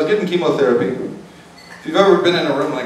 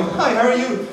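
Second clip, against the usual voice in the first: higher, casual mock receptionist; too-relaxed staff greeting; 8 semitones higher, 3 dB quieter than usual. ER staff greeting a patient in pain, too relaxed. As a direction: greet lightly, without any urgency. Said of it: "a little too relaxed".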